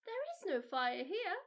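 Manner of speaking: put-on high, loud child's protest; a small girl insisting she is safe; Mildred cries out in protest; protesting little girl. A narrator's reading. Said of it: "cried Mildred".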